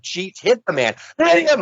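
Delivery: retold yell. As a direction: yell at the screen to hit him. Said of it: "I'm yelling at him".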